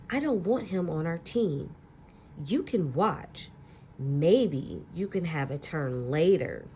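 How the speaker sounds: put-on expressive read-aloud to children, lingering on key words; story narration. Teacher reading a picture book to her class, expressive storytelling.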